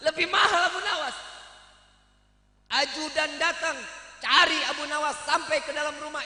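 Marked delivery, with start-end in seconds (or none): 0.1-1.2 louder, higher gleeful punchline. Real, not acted. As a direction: land the joke to the crowd. Joke punchline to audience. (menegaskan lelucon dengan keras)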